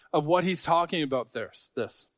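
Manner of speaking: normal talking voice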